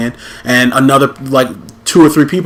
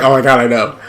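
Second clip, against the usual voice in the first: mock valley girl. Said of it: "talking like that".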